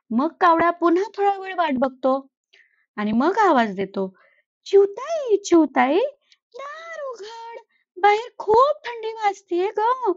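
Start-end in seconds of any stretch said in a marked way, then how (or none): 4.7-10.1 higher pleading crow voice calling out; crow calling at the door. Acted. Cold crow begs the sparrow to open her door, pleading call.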